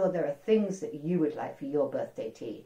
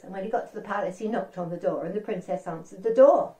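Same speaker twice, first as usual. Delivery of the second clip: ordinary delivery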